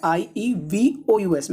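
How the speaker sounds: letters spelled out slowly, one by one; slow spelling out. Shown as a demonstration. Teacher spelling a word slowly for students. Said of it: "mischievous की spelling देखो".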